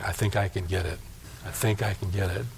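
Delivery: put-on soft, low-pitched boy's reply; hushed quote. Brother creeps toward his cap, quietly determined. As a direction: murmur it low, intent on the goal.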